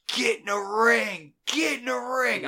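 high, slow shouting from the stands; retold frantic yell. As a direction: scream it at the kid in the ring. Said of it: "I am yelling at the top of her lung".

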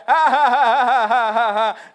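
loud hammed-up jeering laugh; mocking laugh. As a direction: laugh like the crowd jeering at Samson. Philistines mocking blinded Samson.

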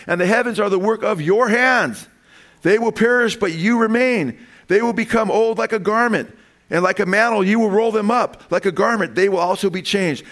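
preacher thundering the Father's words to the Son; fervent preaching. Acted. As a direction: proclaim each line to the back row. Preaches scripture at full force.

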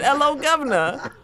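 mock British greeting through laughter; imitated British accent. Podcaster retelling the friend's British attempt, laughing mock British accent. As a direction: mimic a bad British accent while laughing. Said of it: "Then he said".

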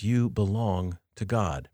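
normal talking voice

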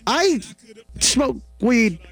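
ordinary delivery

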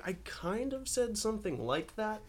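high-pitched, tentative admission; hedging doubt. Retold or imitated. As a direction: pitch it up, half-conceding the quote. Imagined doubtful reaction to magazine quote.

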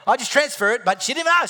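high, loud mock outrage; comic indignation. Real, not acted. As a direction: build to a loud, high punchline. Indignant punchline about wife.

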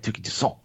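Touki Toussaint with a French ending, t left silent; French pronunciation. Shown as a demonstration. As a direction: put the French on Touki Toussaint, too-SAWN. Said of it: "put that French on it".

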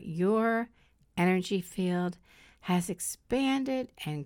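slow, soft meditation voice; soft spa whisper. Acted. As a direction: guide them into calm, slowly. Leads a guided meditation.

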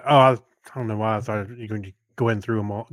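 ordinary talk

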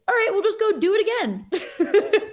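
high, slow, through laughter; retold cheerful resignation. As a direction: laugh off the lost work. Making light of lost footage.

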